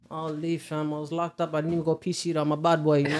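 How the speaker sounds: very soft laid-back mumble; quiet Toronto fam mumble; Money impression. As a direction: lay back and barely push it out. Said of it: "How about Money?".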